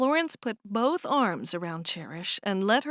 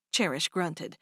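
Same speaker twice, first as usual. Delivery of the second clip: usual speaking voice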